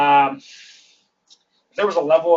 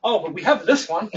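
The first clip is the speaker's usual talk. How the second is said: hopeful pitch; 6 semitones higher, 4 dB louder than usual. Retold or imitated. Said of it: "And she goes".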